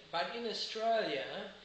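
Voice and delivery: ordinary talk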